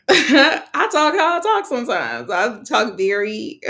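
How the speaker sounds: laughing. Real, not acted. Laughing about her own speech.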